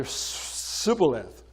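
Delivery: slow Sibboleth with a plain s; giveaway Sibboleth. Retold or imitated. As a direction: say Sibboleth slowly, caught out by the s. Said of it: "And they would say".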